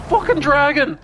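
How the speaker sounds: high, loud mock-panicked yell; mock scream. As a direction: yell it like someone fleeing in fake terror. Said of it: "send away screaming".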